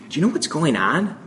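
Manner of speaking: hushed question. Retold or imitated. Hushed tones in the hallway.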